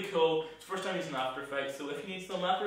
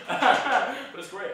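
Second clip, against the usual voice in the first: laughing; 8 semitones higher, 9 dB louder, much slower than usual. Real, not acted. Laughing at the vlog.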